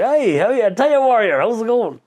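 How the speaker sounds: high-pitched mock lads' greeting; imitating a keen pub greeting; excited hailing. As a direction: hail him like an excited fan. Acting out lads hailing him.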